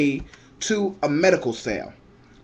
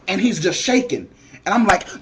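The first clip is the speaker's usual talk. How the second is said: usual speaking voice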